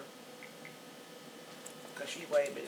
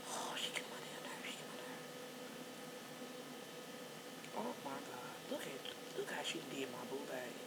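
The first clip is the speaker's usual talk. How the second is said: soft, breathy annoyed aside; whispered grumble; 2 semitones lower, 13 dB quieter than usual. Real, not acted. Grandmother grumbling about ugly baby picture, whispered. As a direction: whisper the complaint under your breath.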